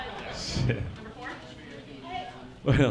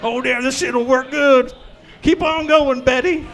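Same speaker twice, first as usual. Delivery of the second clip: tweaker voice; 5 semitones higher, 4 dB louder than usual. Retold or imitated.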